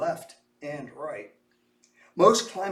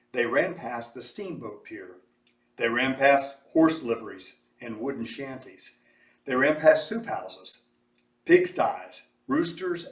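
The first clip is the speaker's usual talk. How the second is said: ordinary delivery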